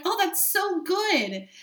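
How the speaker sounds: high, slow, sing-song mock approval; mock sarcastic cheer. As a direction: drawl it out like a fake-cheerful viewer. Mocking what viewers might think.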